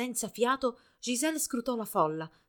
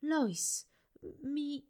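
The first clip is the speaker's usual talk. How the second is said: soft, higher voice trailing off; faltering; 6 semitones higher, 7 dB quieter than usual. A narrator's reading. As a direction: begin hesitantly and fade away. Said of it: "la sua voce si affievolì".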